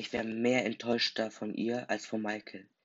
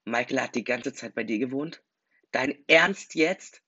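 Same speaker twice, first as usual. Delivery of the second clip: shouting; 3 semitones higher, 8 dB louder than usual. A narrator's reading. Jason shouts at Sarah about hiding Michael, loud and outraged. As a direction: yell it at her in disbelief. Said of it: "schrie ich sie an".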